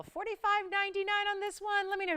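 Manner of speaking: forty-five ninety-nine sung out high and bright; excited price call. Real, not acted. Host hyping price on air.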